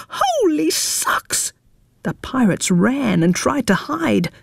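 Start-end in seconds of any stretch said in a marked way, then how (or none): 0.1-1.5 high, slow frightened yelp; pirate squeal. A narrator's reading.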